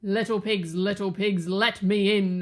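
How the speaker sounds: loud big bad wolf; howling wolf. A narrator's reading. Wolf at the brick house, howling loudly. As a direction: howl the demand at the door. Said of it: "howled the wolf".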